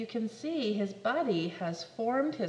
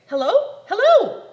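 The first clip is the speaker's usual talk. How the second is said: much higher and louder hello; 10 semitones higher, 15 dB louder than usual. Acted. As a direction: call into the dead line. Hung-up caller.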